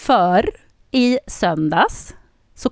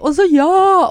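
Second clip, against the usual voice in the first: loud, high cheer; re-enacted screaming; 10 semitones higher, 6 dB louder, slower than usual. Fan reliving the penalty shoot-out, cheering in a high yell. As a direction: cry out like a fan at a goal. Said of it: "Alltså, jag skrek".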